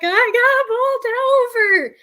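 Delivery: high, slow and loud, through sobs; retold teary wail to her boyfriend on the phone; blubbering. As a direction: let the words wobble out like you're crying. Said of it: "I'm crying, just blubbering".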